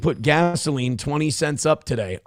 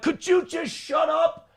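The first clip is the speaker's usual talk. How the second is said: high, slow pleading whine; strained falsetto plea; exasperated plea; 12 semitones higher, 4 dB quieter than usual. Retold or imitated. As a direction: beg them to stop, voice pitched way up. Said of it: "we're like".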